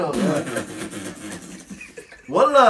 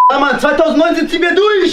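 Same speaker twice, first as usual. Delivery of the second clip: excited; 11 semitones higher, 9 dB louder than usual. Acted. Hyped-up celebration of the new year.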